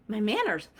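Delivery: normal talking voice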